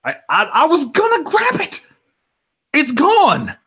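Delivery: flustered high lady caught out. Acted. Miss Lavender flustered, telling the others the button is gone in a high voice.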